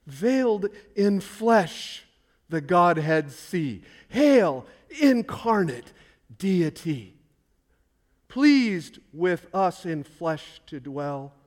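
slow, solemn recited verse; slow proclamation of hymn lyrics; hymn recitation. Acted. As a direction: intone the carol lines reverently.

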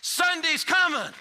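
high, slow, shouted refrain; preacher's shout. Retold or imitated. Pastor shouting the refrain, slow. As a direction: hammer the refrain home. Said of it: "Then he shouted".

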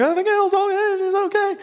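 high, blubbering words through tears; sobbing with relief. Retold or imitated. Telling the teacher his baby is fine, crying with relief. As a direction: choke it out, too overcome to be clear. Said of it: "I can't understand what you're saying".

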